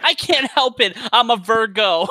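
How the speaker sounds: high, laughing mock excuse. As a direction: squeak out a silly excuse while laughing. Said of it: "repeats the joke".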